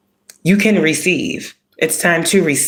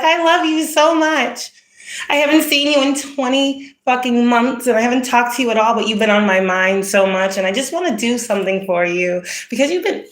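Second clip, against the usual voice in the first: fake sweet admirer; 9 semitones higher than usual. Mocking a phony admirer who returns after months, syrupy. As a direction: lay the fake affection on thick. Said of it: "the one that's like".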